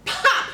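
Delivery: high, sharp popping sound; loud pop. Acted. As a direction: make the button burst. Button popping sound effect.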